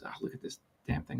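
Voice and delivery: fast, under-the-breath grumble; frustrated muttering. Retold or imitated. Worker grumbling at the assembly he can't tighten, muttered. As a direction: grumble at the part, almost voiceless. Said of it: "he gets a little frustrated".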